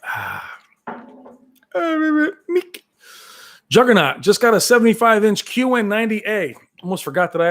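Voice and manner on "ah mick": high drawn-out cry of Mick; Rocky calling Mick. Retold or imitated. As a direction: wail the name up high. Rocky quote again.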